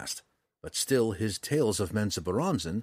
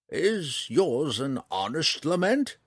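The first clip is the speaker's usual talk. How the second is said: put-on slow, higher-pitched old ranger's question; old ranger, probing; 6 semitones higher, slower than usual. A narrator's reading. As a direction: ask it slowly, with a searching lift.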